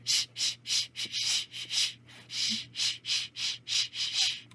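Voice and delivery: soft voiceless rhythmic shushes; rhythmic shh-ing; grass swishing. Acted. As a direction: swish like grass keeping the beat. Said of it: "swished inside the corner store".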